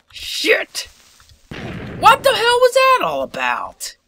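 high, loud baffled outburst; high incredulous exclamation; shocked disbelief. Real, not acted. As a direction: shout in disbelief. Creeper exploded unexpectedly.

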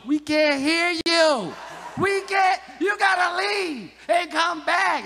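slow, loud and high, over-enunciated for a muted aunt; yelling at Zoom. Retold or imitated. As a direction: shout it slowly so she finally understands.

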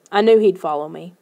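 usual speaking voice

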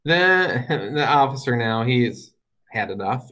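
normal talking voice

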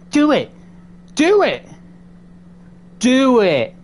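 loud, high taunting yells; shouting dare. Pretend. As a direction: scream the dare at the ghost. Daring the ghost loudly.

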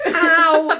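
high laughing cry of mock pain. Real, not acted. Daughter laughing as mom pokes her. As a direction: giggle through the complaint.

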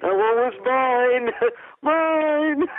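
high, slow, laughing villain squeal; Freddy mocked with a laugh. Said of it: "quoting Freddy's line".

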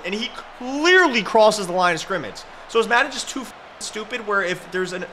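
tearing into the game's logic; angry rant. Real, not acted. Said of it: "I'm going to freak out".

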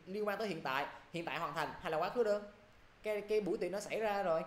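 usual speaking voice

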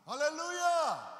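loud, high, long-held cry; preacher's ringing praise call; exultant shout of praise. Real, not acted. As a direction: shout it out and stretch it. Preacher calls out praise to congregation.

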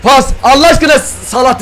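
high, loud burst of excitement; excited shouting. Real, not acted. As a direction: call it out urgently. Excited reaction to pack reveal. (heyecanlı bağırış)

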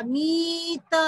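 मीत with a stretched ee, then a separate त; long vowel. Shown as a demonstration. Teacher joining letters into a word, drawn-out मीत. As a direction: hold the ee before the त in मीत. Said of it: "माँ पे बड़ी की मात्रा".

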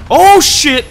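high, loud burst of alarm; startled full-volume yell; alarmed shout. Real, not acted. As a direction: shout it out as danger suddenly hits. Sudden threat in battle.

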